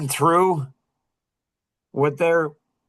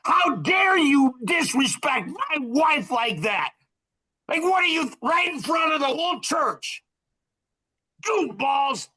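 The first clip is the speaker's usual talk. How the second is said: retold angry shout; 9 semitones higher than usual. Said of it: "Oh, was I mad".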